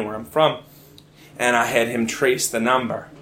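ordinary talk, nothing marked

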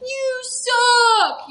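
high mocking jeer; mocking jock. Popular boy jeering at the mascot, high mocking voice. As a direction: sneer it like a shirtless popular boy. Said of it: "he's just like".

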